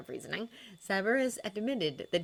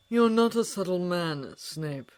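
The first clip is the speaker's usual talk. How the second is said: velvet knife; 3 semitones higher, 7 dB louder, slower than usual. A narrator's reading. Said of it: "like a knife wrapped in velvet".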